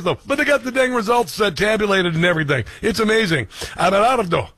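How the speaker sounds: ordinary delivery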